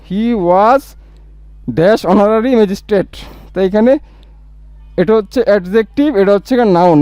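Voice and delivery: normal talking voice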